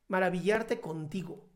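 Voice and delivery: ordinary talk, nothing marked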